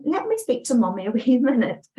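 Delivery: amused, laughing retelling. Real, not acted. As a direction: laugh through the words as you tell it. Laughing while retelling the call.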